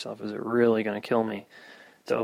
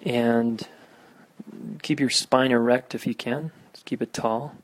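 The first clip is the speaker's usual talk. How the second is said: guided meditation; 5 dB louder than usual. Acted. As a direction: guide listeners through grounding. Leads a meditation.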